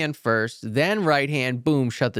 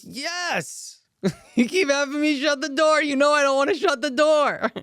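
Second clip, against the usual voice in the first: high whining pupil with a laugh; whiny student, laughing; 13 semitones higher than usual. Retold or imitated. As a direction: whine it out, half laughing at himself.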